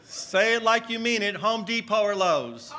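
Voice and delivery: loud challenge called out to a crowd; rallying call. Real, not acted. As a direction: fire up the congregation to shout back. Rallying the congregation to shout answers.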